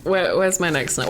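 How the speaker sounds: ordinary delivery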